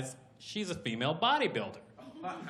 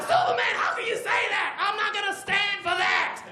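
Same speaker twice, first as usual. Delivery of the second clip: high, loud indignant shouting; 4 dB louder than usual. Acted. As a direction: shout your outrage up high. Hero protests loudly to Superman.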